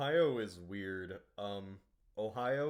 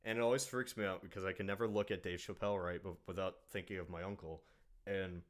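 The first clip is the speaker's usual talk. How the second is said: ordinary talk, nothing marked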